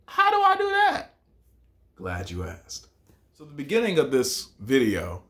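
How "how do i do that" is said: high mock student voice; imagined student asking. Teacher voicing a curious student, high and mock. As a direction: voice a puzzled viewer, pitched way up. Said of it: "you might be asking".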